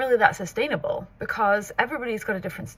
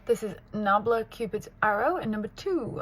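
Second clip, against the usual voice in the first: normal talking voice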